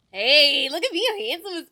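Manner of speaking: embodying the character. Pretend. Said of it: "really embodying the character".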